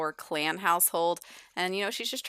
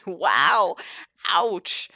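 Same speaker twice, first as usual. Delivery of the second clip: shocked wow; 8 dB louder than usual. Real, not acted. Reacts to rude answer.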